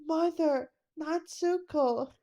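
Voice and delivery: high, drawn-out call to mother; distant longing call. Acted. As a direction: call her name across the sky. Calling out to mother from afar.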